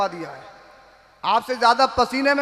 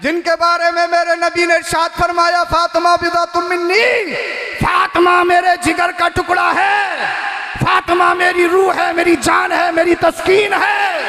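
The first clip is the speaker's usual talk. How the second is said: preaching at full force; 6 semitones higher, 6 dB louder than usual. Acted. Preacher extolling Fatima to listeners, full-force preaching. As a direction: ring it out over the gathering.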